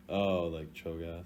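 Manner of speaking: normal talking voice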